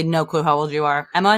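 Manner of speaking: ordinary delivery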